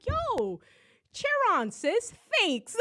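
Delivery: mock thanks. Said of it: "I'm like".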